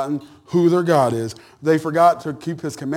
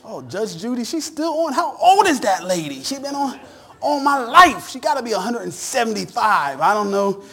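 lightly higher, joking voice; a hint of amused gossip; 6 semitones higher than usual. About Judge Judy, joking aside in a light higher voice. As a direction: tease about Judge Judy's age. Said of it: "I say".

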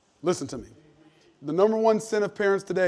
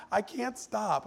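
mock tearful; 5 semitones higher, 4 dB quieter, much slower than usual. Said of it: "cry more in the last three years".

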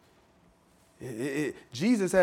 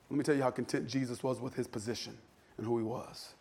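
hushed; 8 semitones lower, 14 dB quieter than usual. Shown as a demonstration. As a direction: drop much lower and softer. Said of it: "same line repeated, then hushed".

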